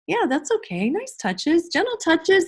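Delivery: put-on high, sing-out encouragement to young children; cheerful approval. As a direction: affirm each one eagerly. Acting out class discussion with children.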